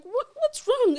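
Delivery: retold alarm, pitched right up; alarmed mother. Mother picking up her crying baby, alarmed.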